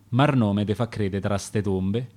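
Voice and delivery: plain reading voice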